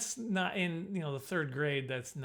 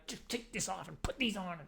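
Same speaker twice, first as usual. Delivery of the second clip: curt teacher; 4 semitones higher, 6 dB quieter than usual. Retold or imitated. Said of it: "stuff adults do when mad".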